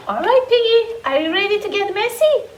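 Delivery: usual speaking voice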